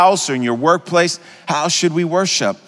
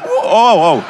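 mock ooh; 4 semitones higher, 4 dB louder than usual. Imitating dancers forgetting themselves.

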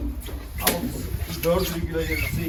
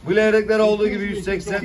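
auctioneer; 6 semitones higher, 6 dB louder than usual. Acted. Fish auction calling prices.

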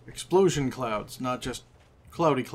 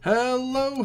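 cheery greeting; 10 semitones higher than usual. Real, not acted. Cheery greeting opening the video.

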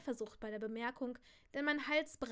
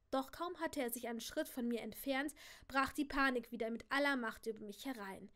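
usual speaking voice

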